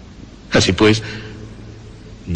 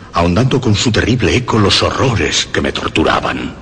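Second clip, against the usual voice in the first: usual speaking voice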